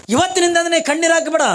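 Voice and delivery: ಇವತ್ತಿನಿಂದ ನೀ ಕಣ್ಣೀರು ಹಾಕಬೇಡ called out high and loud; a divine voice commanding from above; loud proclamation. Acted. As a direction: boom it like a voice from the sky. Heavenly voice proclaims.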